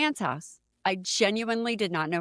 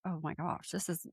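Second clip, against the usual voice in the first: much softer, under her breath; hushed aside; 12 dB quieter than usual. Retold or imitated. Retelling being kissed by a stranger, hushed. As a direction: say it almost to yourself. Shocked thought after a stranger's kiss.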